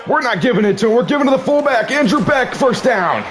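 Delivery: spring the surprise on them; excited reveal. Real, not acted. Trick play reveal.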